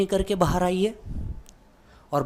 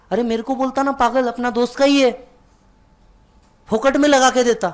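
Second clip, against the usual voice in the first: louder and higher, grumbling that a friend would fit it free; indignant complaint; 7 semitones higher, 5 dB louder than usual. Retold or imitated. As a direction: play the aggrieved dad scolding you over the fan price. Father grumbles about fan price. (ऊँची और तेज़ आवाज़ में शिकायत)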